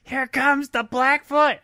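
high, drawn-out shouted movie line; high slow shout. Retold or imitated. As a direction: stretch it out in a high yell. Quoting a Sandler movie line.

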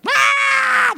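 put-on sudden high shriek of a child losing it; a piercing little-kid wail; toddler meltdown scream. Grandkids melting down at teatime, shrieking. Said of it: "kids are quite high-strung".

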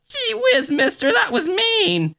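very high, timid wimpy voice, laughing; meek wimp. Pretend. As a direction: squeak it like a scared kid. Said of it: "I'd be like".

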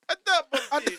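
put-on Italian accent. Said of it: "I can't do an Italian accent".

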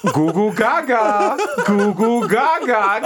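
put-on slow high baby gurgling; baby babble. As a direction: coo like an infant.

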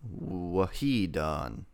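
usual speaking voice

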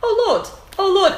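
high, loud mock dismay; shocked interviewer. Co-host imitating the BBC man's reaction to her confession, mock shocked. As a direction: exclaim it like a scandalised interviewer. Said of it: "And he was like".

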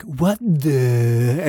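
"What the?" with the "the" drawn out and rising; trailing what the. Retold or imitated. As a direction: let the "the" in "What the?" hang and trail off upward. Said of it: "really trails his "the".

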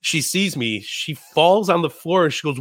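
normal talking voice

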